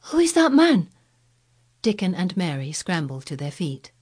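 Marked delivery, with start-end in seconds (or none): 0.0-0.9 high, loud startled question; alarmed loud whisper. Acted. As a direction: cry out in sudden alarm. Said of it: "loud, suddenly alarmed whisper".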